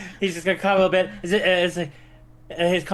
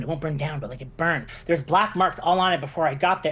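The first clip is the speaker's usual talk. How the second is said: usual speaking voice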